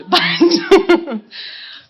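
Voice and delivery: high, loud burst of laughter; real laugh. Real, not acted. Singer cracking up after her rental joke, high and loud. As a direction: crack up at yourself.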